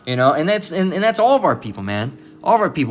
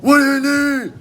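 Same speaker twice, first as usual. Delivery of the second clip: very high, loud mock holler; piercingly high imitated yell; yelling across the street; 13 semitones higher, 4 dB louder, faster than usual. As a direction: imitate someone shouting to the far side. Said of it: "yelling from the other side".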